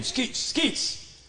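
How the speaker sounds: high dummy voice saying its name; puppet voice. Acted. Puppet says its own name.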